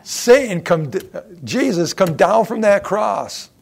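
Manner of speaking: piercing mocking command; Satan's taunt. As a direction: shout the tempter's last dare. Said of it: "Satan's last shot".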